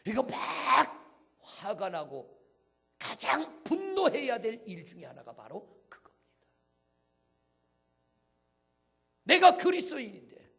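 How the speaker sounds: high, booming sermon cry; impassioned pulpit shout; full-force preaching. Real, not acted. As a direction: proclaim it loudly, stretching the key words.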